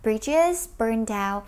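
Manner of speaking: bridges burned down, very slow and linked; Vietnamese: đọc chậm, nối âm bridges burned down; slow linking demo. Shown as a demonstration. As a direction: stretch out bridges burned down so the words run together.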